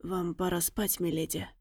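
low, hoarse, quiet murmur. A narrator's reading. Tracker hoarsely tells the lady it's time to sleep, hoarse and hushed. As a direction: rasp it softly, tired guard. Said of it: "хрипло проговорил он".

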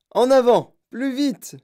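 high-pitched urgent shout; yelled order. A narrator's reading. Wonka waving his cane at the rowers, yelling. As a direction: drive the rowers on, loud start. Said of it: "hurla Monsieur Wonka".

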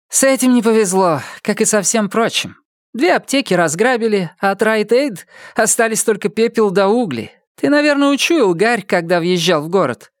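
high, lively voice of a giddy wife; excited wife voice. Acted. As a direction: speak as an overexcited, overtired wife. Lila voiced by narrator.

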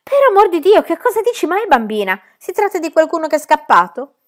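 high, loud terrified shrieks; shrieking in terror. A narrator's reading. Two ladies scared by Pippi at window, terrified shrieking. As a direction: scream in sudden fright. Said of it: "acuti strilli di terrore".

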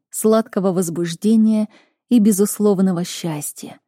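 ordinary delivery, nothing marked

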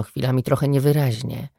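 ordinary delivery, nothing marked

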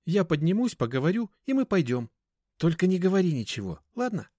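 high-voiced girl giving instructions; girl companion. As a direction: speak up in a light, high girl's voice.

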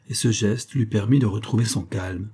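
plain reading voice

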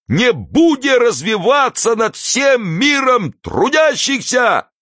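high-pitched, slow oath with long stretched words; impassioned, high-pitched oratory. A narrator's reading. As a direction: whip up the crowd with a drawn-out pledge. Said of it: "он всё возбуждённее простирал руки".

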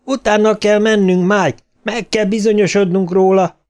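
insistent high-pitched call; shouting back. A narrator's reading. Bill insists they follow the creature, shouting back. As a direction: press the point urgently, pitch held high. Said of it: "kiáltotta vissza Bill".

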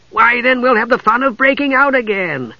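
high, cheerful Kender voice; bright and delighted at the thought. A narrator's reading. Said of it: "Tass said cheerfully".

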